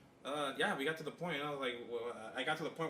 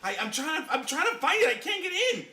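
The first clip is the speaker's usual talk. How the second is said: much higher and louder, laughing through it; squeaky mock whine; 13 semitones higher, 11 dB louder than usual. Mocking a friend who can't find the hole, squeaky and laughing. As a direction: squeal it like a helpless cartoon.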